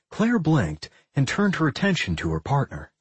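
plain reading voice

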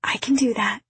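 whispered reply, breath without voice. A narrator's reading. Mother agreeing not to wake the sleeping boy, whispered. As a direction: whisper it back so nobody wakes. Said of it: "We have to whisper".

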